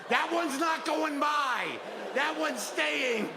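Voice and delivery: high, slow, firm declaration; firm call-out. Real, not acted. Comic to the crowd, high slow call-out.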